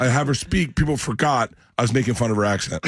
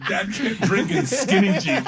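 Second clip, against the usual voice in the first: laughing; 7 semitones higher, slower than usual. Retold or imitated. Repeats daughter's line, laughing.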